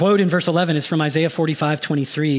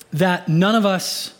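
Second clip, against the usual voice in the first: ordinary talk, nothing marked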